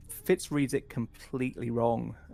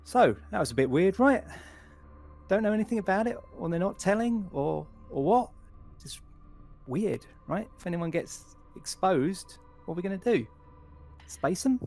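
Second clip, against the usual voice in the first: higher, animated crewman with a broad smile; chatty crewman Fitz; 9 semitones higher, 4 dB louder than usual. Acted. Crewman joining the unwelcoming doctor with a smile, chatty and higher-pitched. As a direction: probe for gossip in a lifted, questioning voice.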